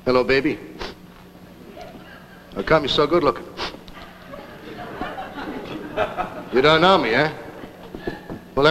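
tough-guy gangster with menacing pauses; movie gangster. Acted. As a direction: play a hammed-up film hoodlum giving orders. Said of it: "this gangster coming attraction looked to me".

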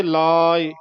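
ਲਾਏ as long "laa" with light short "i"; lengthened "aa". Shown as a demonstration. Teacher demonstrating sihari, long vowel, released ending. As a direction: stretch ਲਾਏ, let go on a brief "i". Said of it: "ਲੱਲੇ ਦੇ ਕੰਨਾ ਵਧਾ ਦਿਓ".

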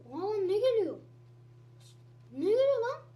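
real surprised outcry; startled shout. Real, not acted. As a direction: caught off guard by a monster. Startled by something coming in game.

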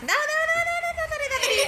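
high-pitched, insistent hurrying; urgent urging. Real, not acted. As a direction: urge them on in a high voice. Urging someone to give quickly. (তাড়া দিয়ে চড়া গলায়)

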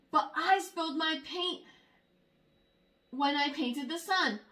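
plain reading voice

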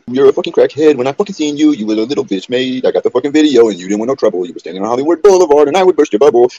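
loud furious rant; furious yelling. Real, not acted. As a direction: yell it at him, furious.

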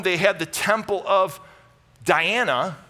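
ordinary talk

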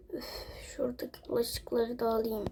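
soft whispered aside. Real, not acted. Woman tidying while family sleeps, whisper. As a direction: whisper so nobody wakes. Keeping quiet in the house.

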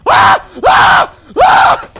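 three short, very loud high screams; horrified screams. Real, not acted. Player finding something horrifying in the game, startled screams. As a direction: scream sharply, burst after burst.

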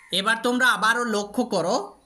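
ordinary talk

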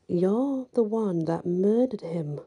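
plain reading voice

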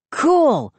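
high, impressed outburst; excited exclamation. A narrator's reading. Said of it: "I exclaimed".

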